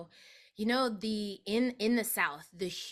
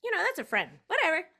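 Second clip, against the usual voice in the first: high, fast and brushing off; dismissive shrug; 10 semitones higher, 6 dB louder, much faster than usual. Retold or imitated. Shrugging off a friend's birth, fast and dismissive. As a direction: wave it away quickly. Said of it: "And I was like".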